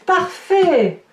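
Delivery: delighted exclaim. Real, not acted. Tarot reader thrilled by the card she draws for Virgo viewers, delighted exclaim. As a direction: cry out pleased as the card turns up.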